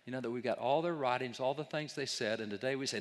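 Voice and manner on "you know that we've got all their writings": ordinary talk, nothing marked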